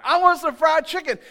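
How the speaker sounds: whiny complaining. Retold or imitated. Israelites grumbling about the manna, mock whine. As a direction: complain like a spoiled crowd tired of its food. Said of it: "That's what they said".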